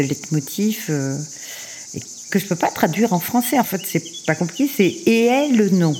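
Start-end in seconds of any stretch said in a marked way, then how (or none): 5.1-5.9 «et elle, non» with a high emphatic rise; stress on the tonic accent. Shown as a demonstration. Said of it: "Si je mets l'accent tonique".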